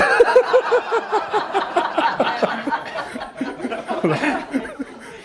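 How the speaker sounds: a long fit of airy laughter; long breathy laugh. Real, not acted. Laughs after being disappointed joke.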